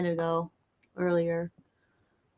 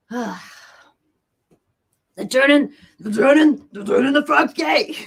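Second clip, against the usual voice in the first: loud, higher, shouted conspiracy-host impression; mock conspiracy shout; 5 semitones higher, 7 dB louder than usual. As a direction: yell it like an outraged radio ranter. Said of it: "I can't do it".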